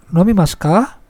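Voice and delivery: "Nomimasu ka" with the pitch rising on "ka"; rising question intonation. Shown as a demonstration. Teacher shows students a polite question, "Nomimasu ka" with rising intonation. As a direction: lift the end of "Nomimasu ka" into a question. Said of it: "lafalkan dengan intonasi naik".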